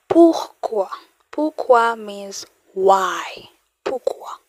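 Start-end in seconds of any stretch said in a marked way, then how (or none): 0.1-0.9 "Pourquoi" said high and loud, both syllables clear; emphatic pourquoi. Shown as a demonstration. Teacher modelling "Pourquoi" for learners, high and loud. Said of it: "teacher models the word". 3.8-4.4 "Pourquoi" breathed in a whisper, no voice. Shown as a demonstration. Teacher repeating "Pourquoi" for learners in a whisper. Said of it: "teacher repeats the word".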